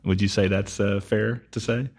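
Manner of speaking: ordinary talk, nothing marked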